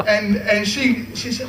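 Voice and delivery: ordinary talk, nothing marked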